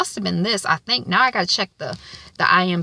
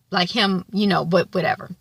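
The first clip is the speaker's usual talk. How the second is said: ordinary talk, nothing marked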